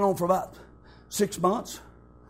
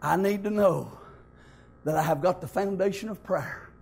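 hushed preaching; 7 semitones lower, 4 dB quieter than usual. Acted. Preacher to the congregation about praying before the storm, hushed. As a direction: pull the room in close and quiet.

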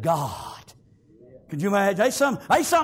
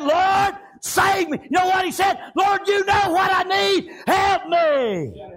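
high, shouted plea for rescue; crying out to God; 11 semitones higher, 4 dB louder, slower than usual. Acted. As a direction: cry it out to the Lord from the heart. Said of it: "he began to cry out".

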